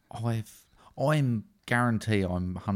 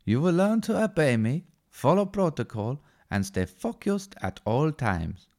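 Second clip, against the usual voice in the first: put-on higher, loud, stiff German accent; Johann Kraus, German accent; 7 semitones higher, 3 dB louder than usual. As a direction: bark orders like a rigid German agent. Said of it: "Johann Kraus".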